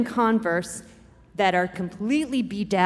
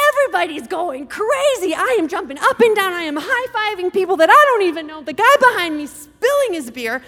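higher, louder, pouring out in a rush; excited; 8 semitones higher, 5 dB louder than usual. Real, not acted. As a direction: relive the win with a rush of joy. Stadium erupts after the play.

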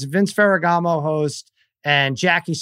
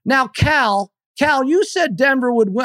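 much higher mock voice of the TV host; imitating Jackie Slater; 9 semitones higher, slower than usual. Retelling the TV host calling him Cal, higher mock voice. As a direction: ham up the old football star correcting him. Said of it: "And Jackie Slater's like".

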